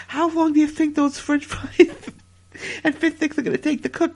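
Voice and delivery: words broken up by giggles; laughing while talking. Real, not acted. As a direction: struggle through the question, laughing. Laughs through his own quote.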